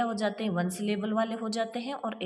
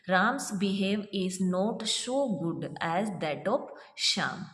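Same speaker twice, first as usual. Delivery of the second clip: slow dictation; much slower than usual. Shown as a demonstration. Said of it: "इसी तरह का एक example और है देखिए".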